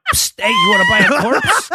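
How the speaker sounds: shady street hawker; secretive raspy sales murmur. Retold or imitated. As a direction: sell it like a back-alley dealer. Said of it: "kind of approach".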